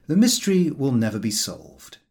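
plain reading voice

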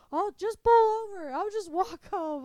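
loud, high exasperated whine; defeated, done. Retold or imitated. Husband gives up on the car quarrel, fed up. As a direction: imitate a husband giving up in protest. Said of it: "he was so defeated. He was so done".

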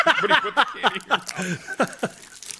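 laughing through the words. Real, not acted. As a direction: crack up mid-sentence. Repeats order while laughing.

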